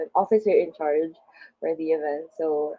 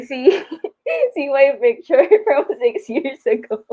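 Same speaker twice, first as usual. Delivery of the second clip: laughing; 6 semitones higher, 6 dB louder than usual. Real, not acted. Laughs at seeing old picture.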